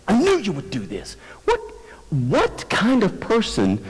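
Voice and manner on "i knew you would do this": Jonah's accusation. Acted. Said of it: "he's attacking God with his own character".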